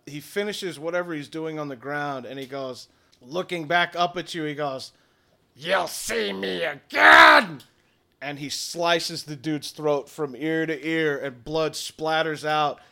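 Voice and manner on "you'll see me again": slow, loud, dramatic villain voice; drawn-out hammed-up threat; villain's parting shout. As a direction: proclaim it as a menacing farewell. Villain's parting threat before escaping.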